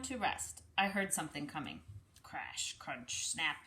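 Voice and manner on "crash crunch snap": hushed sounds. Acted. Something coming through corn.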